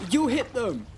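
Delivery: calling a warning. Acted. Boy warning rowers about the rocks, calling urgently. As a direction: call out the danger from the shore.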